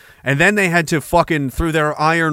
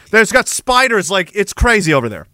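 ordinary talk, nothing marked

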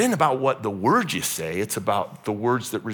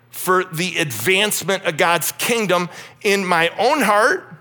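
raised, fervent preaching voice; 7 semitones higher, 5 dB louder than usual. Acted. Pastor urging the congregation toward God's kingdom, preaching loud. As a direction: preach it out with rising calls.

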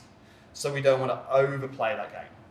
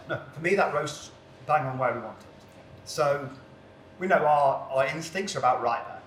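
normal talking voice

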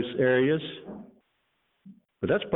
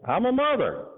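sharp, much higher indignant retort; mother snapping at the clerk, sharply higher; snapped; 13 semitones higher than usual. Acted. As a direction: snap it back, offended. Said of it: "snapped the mother".

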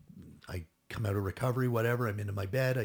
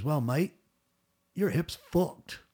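higher, louder attempt at an Australian accent; 7 semitones higher, 6 dB louder, slower than usual. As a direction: imitate a blunt Aussie doctor, not very well. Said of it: "I can't do an Australian accent very well".

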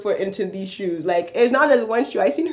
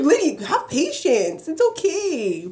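slow soothing; 7 semitones higher, slower than usual. Retold or imitated. Calming the lady, retold.

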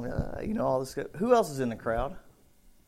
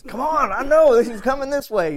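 excited urging; 10 semitones higher, 7 dB louder than usual. Retold or imitated. Buddy excitedly leading blind man.

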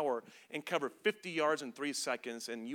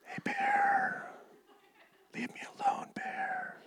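strained stage whisper; comic hushed plea, airy and drawn out; soft tone; slower than usual. Acted. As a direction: whisper timidly to a charging bear. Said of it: "talking in a soft tone".

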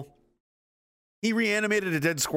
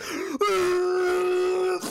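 high shouted heaving cry; strained gasp. Retold or imitated. Brock Lesnar throwing enemies off him, straining cry. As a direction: heave with effort. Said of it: "he's like".

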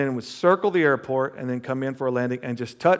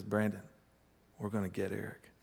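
softer conspiratorial plotting voice; hushed scheming; 5 semitones lower, 13 dB quieter than usual. Retold or imitated. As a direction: keep it down so he won't wake. Plotting prank on sleeper.

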